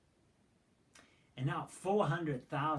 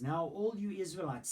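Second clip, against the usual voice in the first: raised call to the crowd; 5 semitones higher, 5 dB louder than usual. Acted. Levite addresses all Israel.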